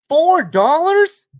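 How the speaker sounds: drawn-out high, loud "four dollars?"; shocked disbelief. Real, not acted. Reacting to a viewer's cheap gas price, stunned.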